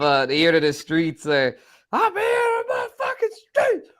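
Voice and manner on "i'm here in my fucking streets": very high squealed hype voice; mock frenzied high-pitched yell; high-energy imitation. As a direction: squeal it like an overexcited character. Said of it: "High energy".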